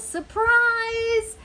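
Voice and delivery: high, loud, stretched shout; party yell. Acted. Party guests to someone walking into the house, joyful yell. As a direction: yell it out like a whole party crowd. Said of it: "everybody yells".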